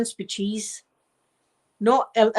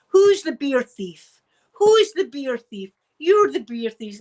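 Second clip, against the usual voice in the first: louder, slow, sing-song accusation; mock accusing; 4 semitones higher, 5 dB louder, slower than usual. Daughter accusing dad of stealing beer, loud and teasing. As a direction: tease him by calling him out. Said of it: "And she's going".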